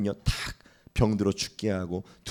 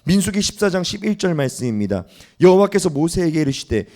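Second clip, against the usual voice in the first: ordinary talk, nothing marked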